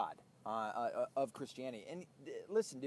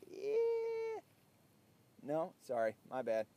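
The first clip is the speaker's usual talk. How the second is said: ordinary talk, nothing marked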